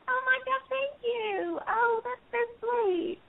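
a sugary hammed-up Southern thank-you; slipping into Southern. Actress thanking a fan, slipping into a high Southern gush. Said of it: "I immediately go into it".

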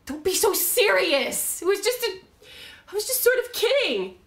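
very high, loud, strained denial; panicky, overwrought backpedalling; desperate protest. Acted. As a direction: insist frantically that it was harmless. Protesting it was a joke.

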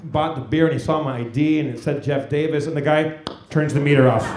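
ordinary delivery

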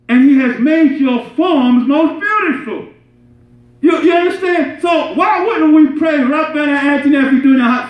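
high-pitched, insistent sermon voice; urgent, emphatic preacher; impassioned preaching. Real, not acted. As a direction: exhort the congregation, driving each point home.

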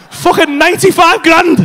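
comic shock at full volume; appalled outburst. Real, not acted. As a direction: shout the price in outrage. Reveals the shocking car price.

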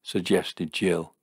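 usual speaking voice

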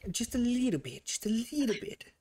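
high, tiny teasing voice; playful high-pitched downplaying; coy minimizing. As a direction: squeak it small to play it down. Playfully minimizing what he took.

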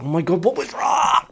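high-pitched "oh my god" in disbelief; exasperated disbelief. Real, not acted. Gamer baffled by missing the anomaly, shrill disbelief. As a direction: squeal it in frustrated surprise.

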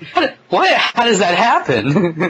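put-on kids who just watched the phone fall, asking in laughing disbelief; baffled surprise. Laughing disbelief.

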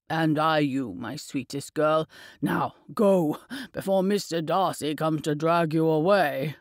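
a hint of a tender old man chiding his daughter; old father. A narrator's reading. Narrator voices the father.

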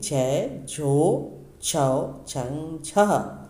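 each syllable called out loud and drawn out; loud syllable drill. Shown as a demonstration. Hindi teacher drilling children through the barakhadi, loud and deliberate. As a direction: chant them crisply for young pupils to copy.

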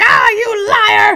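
high, drawn-out theatrical yell; mock accusing shout. Houdini exposing a fake medium, high, loud mock accusation. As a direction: shout it like a triumphant unmasking. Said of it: "rip off his disguise and be like".

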